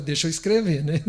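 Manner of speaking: mock-flustered, laughing through it; lightly voiced scared Chico, laughing; nervous laughing. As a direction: hurry the words out with a nervous laugh. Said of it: "olhou e falou".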